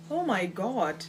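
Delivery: ordinary talk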